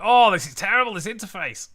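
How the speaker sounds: loud, high burst of real exasperation; exasperated. Real, not acted. Host fighting a clumsy interface mid-stream, loudly exasperated. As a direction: blurt out your frustration, loudly.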